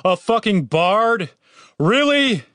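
high, drawn-out, disgusted yell; outraged shout. A narrator's reading. Man protesting his class to the sky, shouting. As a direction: yell it upward in disbelief. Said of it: "He turned to the sky and shouted".

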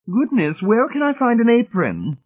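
very high, coy matron; high, effusive society lady; fluttery matron. Acted. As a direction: flutter about helpfully, voice pitched sky-high. Fluttering matron enters the kitchen.

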